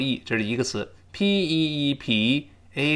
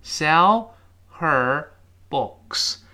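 SELL... HER... BOOKS, pausing between words; each word slowly, H of HER sounded; slow reading; 2 semitones higher, much slower than usual. Shown as a demonstration. Teacher repeating the slow version, word by word. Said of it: "这读得很慢".

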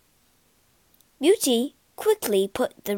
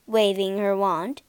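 usual speaking voice

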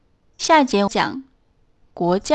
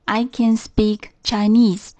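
slow, careful textbook English; slow model sentence; slower than usual. Shown as a demonstration. Teacher modelling a sentence for students, slow and careful. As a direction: model it slowly for learners. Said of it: "肯定形式".

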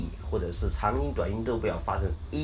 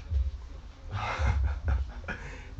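breathy, helpless chuckle; amused laugh; 4 dB quieter than usual. Real, not acted. Teacher laughing at imitated student mistake, breathy chuckle. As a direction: crack up at your own demonstration.

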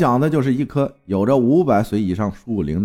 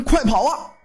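sudden yell; 12 semitones higher than usual. Acted. Someone warns the crowd to flee, yelling. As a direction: cry out high as if warning a crowd. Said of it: "突然大喊了一声".

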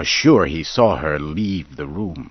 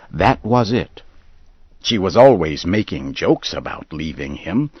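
ordinary delivery, nothing marked

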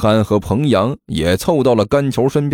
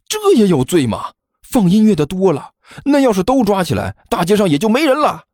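higher, cheeky protest of innocence; innocent-acting fat boy; 8 semitones higher than usual. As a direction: play the wronged innocent, pitched up. [name]'s voice, protesting innocence. (高声装无辜的胖子)

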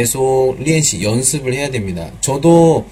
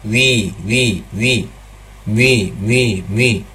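a bit faster; much slower than usual. Shown as a demonstration. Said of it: "조금 빨리".

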